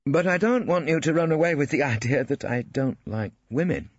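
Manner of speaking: awkward stiff gent, understated; lightly voiced stiff bachelor. A narrator's reading. Ballard boasting of his flat to Phillida, lightly voiced.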